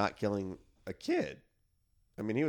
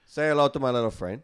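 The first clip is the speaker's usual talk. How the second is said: put-on mild Scarface accent; 4 semitones higher, 7 dB louder than usual.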